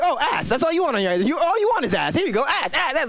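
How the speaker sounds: high mocking app voice; Instagram's voice. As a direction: tease like a pushy algorithm. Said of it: "Instagram's like".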